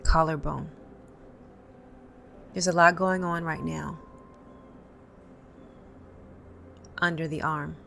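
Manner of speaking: calm, paced guided tapping with pauses between points; guided tapping script. Acted. Tapping coach to viewers, calm guided script. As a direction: lead the viewer through tapping points and phrases to repeat.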